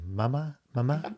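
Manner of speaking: imitating a British-accented "mama"; lightly British "mama", questioning rise. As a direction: copy a little kid's British "mama". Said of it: "Malibu has a British accent".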